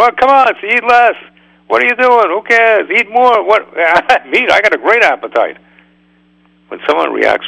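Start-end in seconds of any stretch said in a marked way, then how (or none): 0.0-5.6 high, loud, bickering inner voices; a rabbi voicing the inner voices of an eating disorder, high and loud; mock inner voices. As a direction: play the voices arguing about food, much higher.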